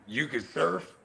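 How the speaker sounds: mock questioner. Imitating an outsider quizzing a Californian about surfing, low mock voice. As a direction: put on a low voice for the clueless asker.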